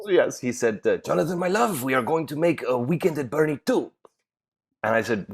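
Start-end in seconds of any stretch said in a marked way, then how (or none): 1.0-3.9 lightly put-on producer accent, pitched up; a mild attempt at the eccentric producer's voice. Retelling how the eccentric producer pitched the sequel, in a lightly put-on accent. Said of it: "I can't do his accent".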